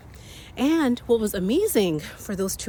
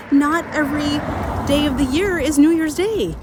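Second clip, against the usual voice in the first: louder, higher, punching the point; emphatic; 5 semitones higher, 6 dB louder than usual. Real, not acted. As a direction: drive home the point.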